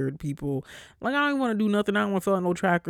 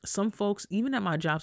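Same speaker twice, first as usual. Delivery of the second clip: usual speaking voice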